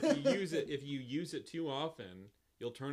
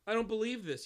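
strangled gasp; 9 semitones higher, 7 dB louder than usual. A narrator's reading. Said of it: "you gasp. In a strangled voice".

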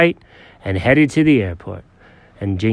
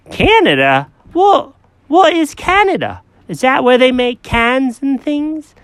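high, fussy cat voice; Jenkins the cat; 13 semitones higher, 4 dB louder than usual. Acted.

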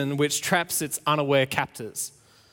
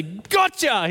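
gotcha; 4 semitones higher than usual. Retold or imitated. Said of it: "open up and say".

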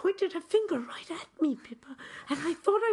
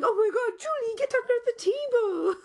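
hammed-up panicked yell, higher; mock yelling; 8 semitones higher, 4 dB louder than usual. Friends yelling at her to hide under the table, in a mock panic. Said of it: "everyone's yelling".

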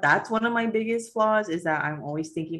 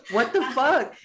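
higher-pitched mock outrage; theatrical exasperation; dramatic outburst; 7 semitones higher, slower than usual. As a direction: play up the indignation. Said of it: "I was being dramatic".

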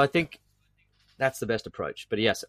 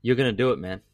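firm, a little louder, reassuring; encouraging; 4 dB louder than usual. Acted. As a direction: say it to your younger self like a promise. Advice to eighteen-year-old self.

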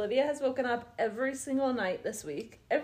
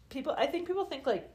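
ordinary talk

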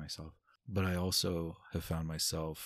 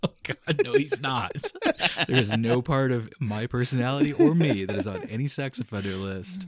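louder, higher laughing denial; laughing protest; 7 semitones higher, 13 dB louder than usual. Real, not acted. Man denying his friend's joke while laughing, louder and higher.

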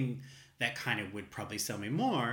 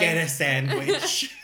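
joking advice to a hungry stranger, loud slow laugh; laughing, much louder, slow; 4 semitones higher, 10 dB louder, slower than usual. Real, not acted.

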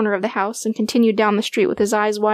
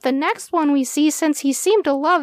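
small dog's inner thoughts; 6 semitones higher than usual. A narrator's reading. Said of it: "thought Stretch".

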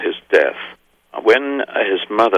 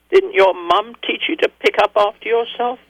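put-on child's joke; 14 semitones higher than usual. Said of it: "That's what he said to me".

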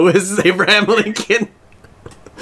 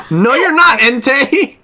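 mock outraged retort; 7 semitones higher, 4 dB louder, slower than usual. Talking back to the villain.

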